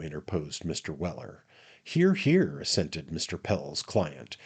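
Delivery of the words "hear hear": high, raised cry of agreement; hearty assent. A narrator's reading. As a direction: back the speaker with a cheer. Client cheering the boast.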